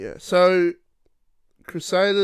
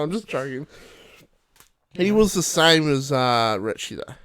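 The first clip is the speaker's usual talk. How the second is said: ordinary talk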